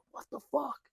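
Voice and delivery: high, hushed alarm; imitated panic. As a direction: imitate a frightened friend, high and soft. Retold panic of Jess grabbing him.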